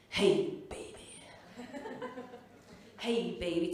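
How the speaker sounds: low, put-on American seducer; mock-sexy low American bed talk; American accent. Partner talking in bed, in a low American accent. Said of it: "I give him an American accent".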